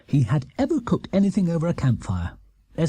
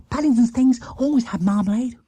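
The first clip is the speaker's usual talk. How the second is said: put-on Jonathan, eager boy; 9 semitones higher than usual. Jonathan voiced in story.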